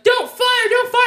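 high, urgent mock-dad cry; acted high-pitched alarm; panicked warning. Retelling dad's panicked warning.

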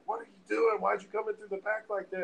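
high-pitched scolding question; mock outraged questioning; indignant nurse. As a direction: challenge them like a startled nurse. Retold nurse challenging him.

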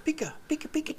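very high, soft Pikachu squeak; Pikachu cry. Pretend. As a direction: chirp it like the little yellow Pokemon. Imitating Pikachu on Pokemon.

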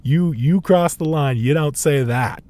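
God. Retold or imitated. God looking down at people cursing, mock scolding. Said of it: "God's looking down and being like".

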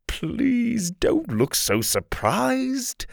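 Kai, mocking. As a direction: tease the startled boy. Kai taunting.

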